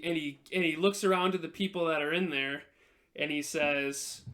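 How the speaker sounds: usual speaking voice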